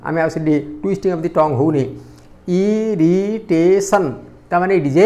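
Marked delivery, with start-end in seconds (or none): 2.5-4.1 "irritation" slowly in four beats, ଇ-ରି-ଟେ-ସନ୍; "irritation" divided into four slow syllables. Shown as a demonstration. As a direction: stretch every syllable of "irritation". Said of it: "four syllabic word".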